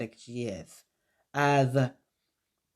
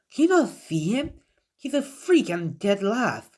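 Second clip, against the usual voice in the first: high, incredulous sneer; indignant Sakura; 7 semitones higher than usual. Acted. Sakura insisting to Yamato that Naruto is a freak, high and indignant. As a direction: pitch it way up, disbelieving and scornful.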